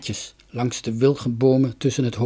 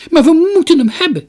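much higher and louder; desperate cry; 12 dB louder than usual. A narrator's reading. Girl begs the merchant for the parrot, crying out. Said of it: "riep Donja".

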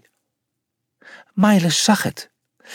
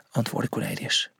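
ordinary delivery, nothing marked

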